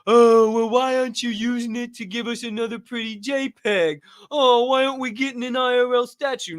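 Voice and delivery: high, whiny mock complaint; mock whiny community. Host mocking a whiny NFT community, high whiny voice. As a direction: whine like an entitled holder. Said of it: "The community's not".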